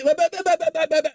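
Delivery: high, rapid-fire "bah bah bah"; mock shushing. Host heading off viewers' objections, playful high interrupting. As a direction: cut them off with high babbled syllables.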